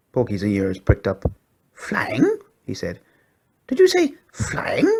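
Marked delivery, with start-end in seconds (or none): 1.7-2.4 very high rising disbelief; a little dog who can't believe it; high squeaky pug, amazed. A narrator's reading. Porky's voice, amazed. 3.7-4.9 very high and slow, drawn-out disbelief; high pug voice, astonished; disbelieving squeaky pug. A narrator's reading.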